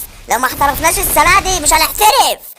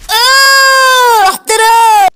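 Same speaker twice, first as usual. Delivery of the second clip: high, loud stretched cry; triumphant shout; 7 semitones higher, 5 dB louder, much slower than usual. Real, not acted. Gamer celebrating a play, high loud triumphant cry. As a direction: belt it out like a winning goal.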